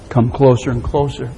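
ordinary talk, nothing marked

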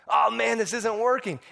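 high-pitched mock frustration; exasperated. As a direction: whine that it's all going wrong. Adam's failed attempt acted out.

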